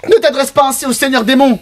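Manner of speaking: high, stern rebuke; scolding villager voice. Acted. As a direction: scold him sharply. NPC scolds the child. (voix aiguë qui réprimande)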